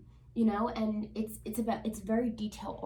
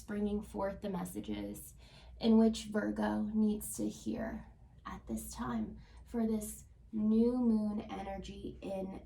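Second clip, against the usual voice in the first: prayerful invocation; slower than usual. Acted. Tarot reader blessing the cards for Virgo viewers, hushed prayerful invocation. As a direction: call on spirits gently, as if at an altar.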